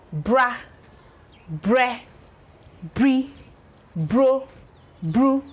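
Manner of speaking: bra bre bri bro bru, each with a rolled R and no L; teacher modelling the R sound; R blend demo. Shown as a demonstration. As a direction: model each br syllable slowly. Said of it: "You can see the re, not the li".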